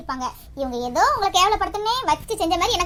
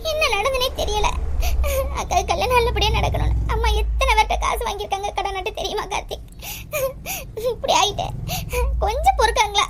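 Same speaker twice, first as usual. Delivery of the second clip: tearful, sobbing between phrases; crying; 2 semitones higher than usual. Acted. Bride's sister-in-law fearing village gossip for her parents, crying. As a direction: plead and lament through sobs. Weeping over the family's shame.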